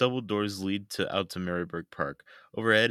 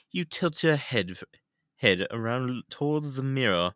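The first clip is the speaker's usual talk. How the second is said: Queen's English, higher and slowed; British accent; 5 semitones higher than usual. Acted. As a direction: read it like the Queen. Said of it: "read it British now".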